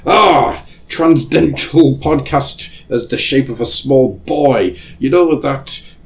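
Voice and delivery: hammed-up old Scrooge; old man voice. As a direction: marvel at the boy like a Dickens character. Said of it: "And then they say".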